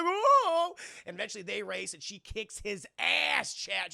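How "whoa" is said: much higher, loud startled whoa; mock shocked whoa. Racer recoiling from Letty's threat, loud high mock surprise. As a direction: yelp it like you just got burned.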